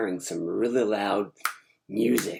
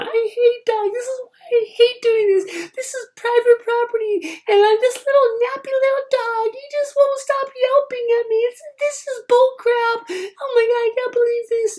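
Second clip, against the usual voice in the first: put-on grumpy old woman; 9 dB louder than usual. Elderly wife complaining about the yelping dog, shrill mock voice. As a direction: play a fussy elderly woman fed up with dogs. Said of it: "she was rambling on".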